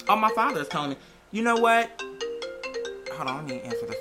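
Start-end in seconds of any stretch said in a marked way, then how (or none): none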